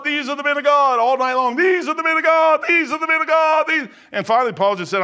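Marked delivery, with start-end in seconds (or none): 0.0-3.9 loud, high preacher's cry; booming pulpit delivery; full-force preaching. Acted. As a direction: preach it out to the whole room. Preaching the opening verse.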